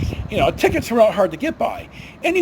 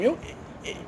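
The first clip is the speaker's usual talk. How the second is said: ordinary delivery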